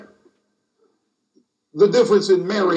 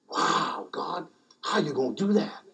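soft awed question; 4 semitones lower, 9 dB quieter than usual. Retold or imitated. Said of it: "your tone will change".

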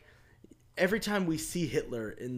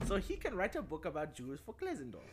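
softer mock German accent; 8 dB quieter than usual. Host reading the plot in a put-on German accent. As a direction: keep the German accent going. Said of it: "the German accent".

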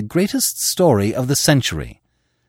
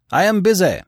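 high raised voice through a door; shouting; 11 semitones higher, 4 dB louder than usual. A narrator's reading. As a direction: call it out to send them off. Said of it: "shouted the editor".

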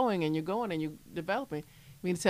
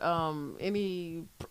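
ordinary delivery

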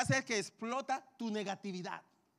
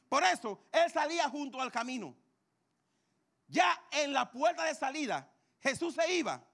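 normal talking voice

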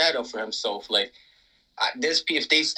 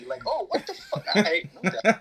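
laughing; 5 semitones higher than usual. Real, not acted. Laughing reaction to recipe.

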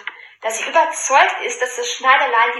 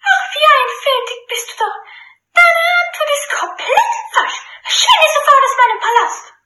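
high, mocking princess, louder; gloating; 5 dB louder than usual. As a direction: gloat over his wrong answer. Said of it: "voller Schadenfreude".

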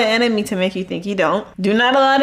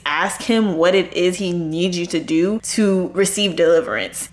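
usual speaking voice

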